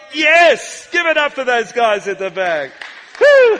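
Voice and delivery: loud excited cheer. Real, not acted. Preacher cheering the tech crew at the back, loud whoop.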